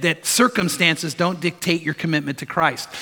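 normal talking voice

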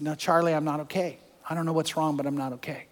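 very soft, almost breaking off; hushed father's words. Retold or imitated. Preacher voicing his frightened father to the congregation, very soft. Dying father admits fear.

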